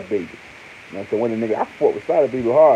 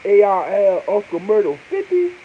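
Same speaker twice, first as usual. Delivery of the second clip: higher and louder, building to a squeal; excited listing; 9 semitones higher, 6 dB louder than usual. Real, not acted. Fan naming the dream lineup, excited high voice. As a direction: call out each name like a dream team.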